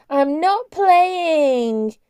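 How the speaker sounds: put-on very high, very slow sneering child's whine; mock her sister like a sulky toddler; squeaky jeering voice. A narrator's reading. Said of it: "Cart put on a squeaky, jeering voice".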